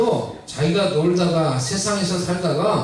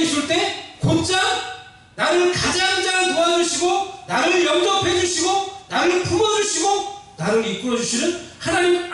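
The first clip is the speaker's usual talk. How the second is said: exhortation rising steadily higher; rousing preaching; 6 semitones higher than usual. Real, not acted. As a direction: build the sermon phrase by phrase in a raised pitch. (점점 고조되는 힘 있는 설교)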